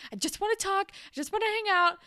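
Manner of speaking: put-on high, loud, fast desperate girl; a troubled girl holding scissors, pleading with her teacher; pleading teen. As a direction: plead it high and quick, a bit unhinged. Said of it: "And she's like".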